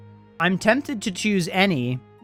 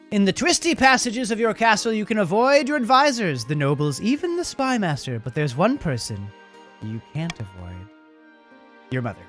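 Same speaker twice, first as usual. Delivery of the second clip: dramatic narration; 2 semitones higher than usual. Acted. Reads game event text.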